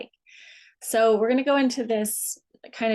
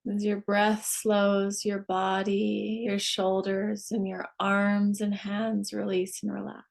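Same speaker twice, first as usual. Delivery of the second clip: guided meditation. Acted. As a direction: ease them down unhurriedly, phrase by phrase. Leads a guided relaxation.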